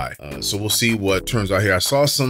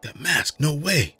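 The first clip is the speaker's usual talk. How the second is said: high, stunned gasp of disbelief; shocked disbelief; 8 semitones higher, slower than usual. Acted. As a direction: jump up high in sudden shock. Boruto shocked by the mask.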